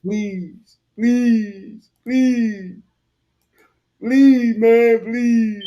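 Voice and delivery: high, loud whiny plea drawn out; exaggerated falsetto begging; mocking the beggar. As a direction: ham up a frightened man begging. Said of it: "mimicking the victim".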